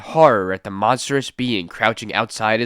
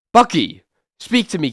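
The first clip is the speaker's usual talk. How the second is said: urgent higher call; 7 semitones higher, 4 dB louder than usual. Acted. Urgent call to hurt buddy.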